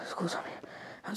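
whispered apology. Acted. Apologizing after panic attack.

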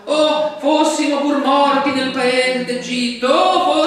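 high, loud, slow mock wail; wailing lament. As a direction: cry out like a grieving crowd. Reading Israel's wailing lament. (lamento esagerato, acuto e forte)

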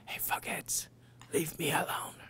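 secret whisper into his ear. Acted. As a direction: whisper it so only he hears. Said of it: "I leaned over and whispered".

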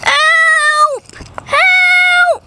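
desperate cry for help. Acted. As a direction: shout for someone to save him. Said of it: "he cried".

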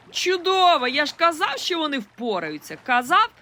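high, loud victorious cry; boy shouting in triumph; triumphant yell. A narrator's reading. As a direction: crow over being right. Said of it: "переможно заволав Дане".

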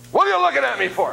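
high, loud cranky snap; grumpy old man. Retold or imitated. Old man in the seat snapping at the pastor, high and loud. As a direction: bark it like a grouchy old passenger. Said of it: "He looks up at me and says".

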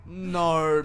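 a loud, anguished no as things go wrong; loud dismayed no. Real, not acted. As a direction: cry it out in dismay. Reacting to friend getting hurt.

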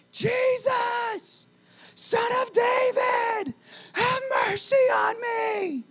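high, slow desperate shout. Acted. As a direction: shout over the crowd, pleading. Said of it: "He gets louder".

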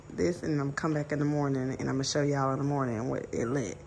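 ordinary delivery

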